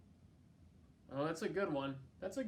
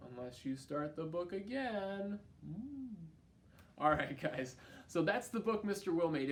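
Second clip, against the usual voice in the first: ordinary delivery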